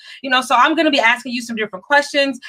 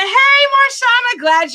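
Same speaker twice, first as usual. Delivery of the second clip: high, slow, delighted hello; excited greeting; 11 semitones higher, 5 dB louder, slower than usual. Real, not acted. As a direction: greet her like a long-lost friend. Greets viewer on live.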